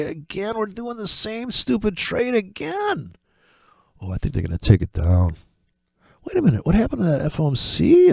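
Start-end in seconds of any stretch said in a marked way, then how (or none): 0.0-3.2 much higher, slow and softer mock whine; high drawn-out sarcastic complaint; sarcastic singsong. Real, not acted. Self-mocking repeated trade. 6.2-8.1 much higher, faux-shocked wait a minute; high mock double take; mock bafflement. Mock confusion about market.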